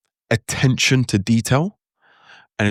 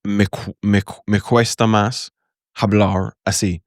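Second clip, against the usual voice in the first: halting Spanish in a plain English accent; slow Spanish with untouched English vowels and intonation; slower than usual. Shown as a demonstration. Teacher showing learners Spanish without any imitation, heavy English accent. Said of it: "speak normally in my normal English voice, normal accent".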